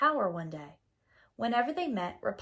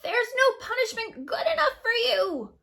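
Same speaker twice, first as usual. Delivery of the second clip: witch screech; 14 semitones higher, 7 dB louder than usual. A narrator's reading. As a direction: screech it like a furious old witch. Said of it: "In a terrible rage".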